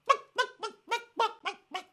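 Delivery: very high, loud "buck, buck" clucks, fading; imitated bird call. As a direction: cluck shrilly like a strange bird. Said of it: "like a bird of some kind".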